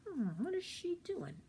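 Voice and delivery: put-on puzzled boy thinking. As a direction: muse it quietly, baffled. Simon wonders to himself.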